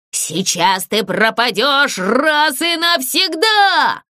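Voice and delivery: a ghost passing judgement; stern ghost. Acted. Ghost scolds the puppet.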